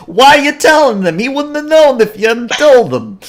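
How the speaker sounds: loud, high-pitched exasperated scolding; scolding bear. Acted. Orion bear berates his partner for telling, high, loud scolding. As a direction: yell at your blundering partner in a put-on bear voice. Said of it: "slaps him in the back of the head and says".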